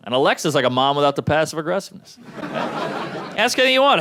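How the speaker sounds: ordinary talk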